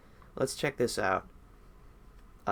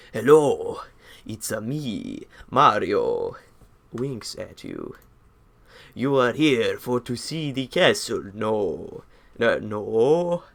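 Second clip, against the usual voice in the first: hammed-up Italian Mario accent, louder; 5 dB louder, slower than usual. Streamer voicing Mario greeting the player in an Italian accent. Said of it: "let me do a voice for this".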